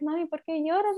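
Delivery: put-on a child asking why mom cries; young daughter. Daughter asking her crying mother why.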